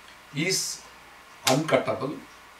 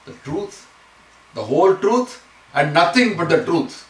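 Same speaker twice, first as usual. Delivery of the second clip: emphatic, rising oath, louder on the last two parts; oath recital; 5 semitones higher, 6 dB louder than usual. Retold or imitated. Teacher reciting the courtroom oath to show repetition, emphatic. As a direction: build the oath phrase by phrase for emphasis. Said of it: "you say".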